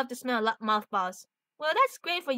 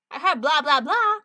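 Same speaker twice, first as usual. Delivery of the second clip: louder, rising blah-blah; mock dismissive; 5 dB louder than usual. [name] answering Peter's question, mock dismissive. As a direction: brush it off with loud blahs. Dismissive comeback to being lectured.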